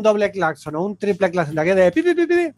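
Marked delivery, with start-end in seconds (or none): 2.0-2.5 high, quick horn beeps; car horn beeps. Retold or imitated.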